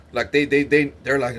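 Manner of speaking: ordinary delivery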